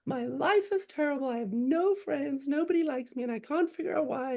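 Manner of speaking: high, wailing retold lament; imitated sobbing daughter. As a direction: wail it like a despairing child. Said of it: "she started sobbing".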